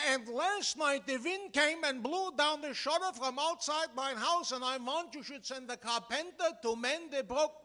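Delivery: lightly voiced flustered caller with Yiddish-tinged accent; comic immigrant on the phone. Tenant calls the bank about his shutter, growing exasperated. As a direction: play the harried tenant shouting into the phone. Performs the Cohen telephone sketch.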